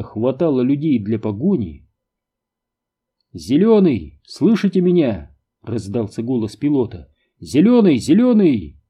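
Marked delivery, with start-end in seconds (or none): 3.3-5.4 raised-pitch, urgent calling; radio call. A narrator's reading. Pilot calls team over radio. 7.4-8.8 high, loud repeated hail; urgent radio call. A narrator's reading.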